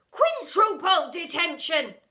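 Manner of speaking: loud, slow, laughing verdict; gloating teacher. Acted. Miss Seeth punishing pupil, gloating. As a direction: relish the bigger number.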